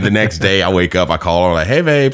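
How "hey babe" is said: higher-pitched acted greeting to a partner; light, lifted hello, well above usual pitch; sweet phone greeting. Husband calls his wife the next morning, lightly high-pitched greeting.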